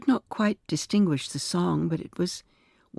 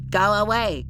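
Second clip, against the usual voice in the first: high, flat, slapping quack; quacking doll; 5 semitones higher, 4 dB louder than usual. A narrator's reading. Doll drives Miss Harper away, quacking outrageously. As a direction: quack it out harshly in a raised doll voice. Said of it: "quacked the doll".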